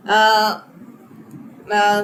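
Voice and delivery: ordinary talk, nothing marked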